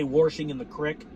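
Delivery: "warshing" with an r, "crick" rhyming with stick; folksy Midwestern "warshing in the crick"; Midwestern accent. Shown as a demonstration. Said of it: "heavy Midwestern accent".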